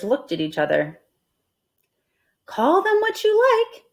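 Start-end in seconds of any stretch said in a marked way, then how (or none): 2.5-3.8 high bright-voiced wife; Missus Rogers voiced. Missus Rogers answering Amelia Bedelia, voiced high. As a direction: lift the voice up for the wife. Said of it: "said Missus Rogers".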